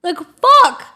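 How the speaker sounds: loud, high-pitched fed-up rant; exasperated outburst. Retold or imitated. Passenger losing it at kid hitting his mom, exasperated. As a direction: vent it loudly, at breaking point.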